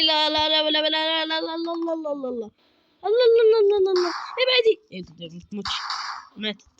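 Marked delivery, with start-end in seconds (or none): 0.0-2.5 panicked no. Real, not acted. Panicking as granny attacks. 3.0-4.8 much higher, loud frantic cries; terrified shouting at the granny; panicked yelling. Real, not acted.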